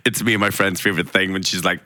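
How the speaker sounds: ordinary delivery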